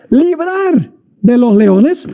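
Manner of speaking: slow, deliberate "librar de los leones" with weight on "librar"; key word stressed. Shown as a demonstration. Preacher pointing the congregation to the key word, slow stress on "librar". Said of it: "aquí está la palabra clave".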